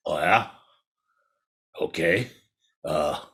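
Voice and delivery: low, soft tiger voice; low, hesitant storybook tiger; skeptical tiger. A narrator's reading. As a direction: mull it over as the big cat. Tiger considers the challenge.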